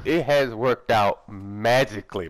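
slowly savouring every word; drawn out. Real, not acted. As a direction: stretch it out, pleased.

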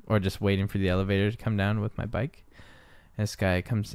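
ordinary talk, nothing marked

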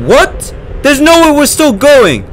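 high, loud disbelieving shout; shocked scream. Real, not acted. Streamer can't believe the game keeps going, shocked scream. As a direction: cry out in disbelief.

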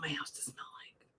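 soft unvoiced whisper; quiet confiding whisper. Real, not acted. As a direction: keep it hushed. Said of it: "I have to whisper it".

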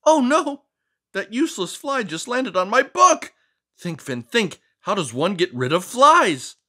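high, dramatic fussy cartoon voice; fussy book character. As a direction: overdramatic neat-freak narrator of the book. Finn voice again.

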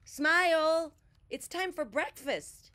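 high, loud calling voice. A narrator's reading. Bear calls Smile to breakfast, loudly. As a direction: call to a friend you cannot see.

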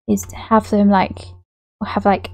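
ordinary talk, nothing marked

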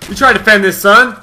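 high, loud mock taunt; hammed-up aggressive shout; shouted challenge. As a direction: yell it like trash talk at a rival. Acted challenge, repeated as a shout.

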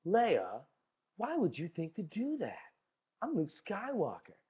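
a deliberately shitty Luke; bad Luke Skywalker impression. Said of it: "It's a shitty one".

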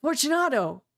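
high, loud call into the dark. Acted. Montresor calling into the sealed niche, a high loud call. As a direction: call his name into the niche. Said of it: "I called aloud".

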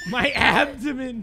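slow, laughing repeat; laughing echo. Retold or imitated. Echoes friend's line, laughing.

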